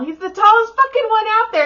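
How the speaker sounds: very high, loud insistence; retold exasperated excitement; excited pointing-out. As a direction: shout it to someone searching the crowd.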